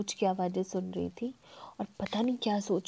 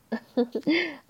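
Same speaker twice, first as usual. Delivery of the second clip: giggle; 5 semitones higher than usual. Acted. Narrator laughs after quote.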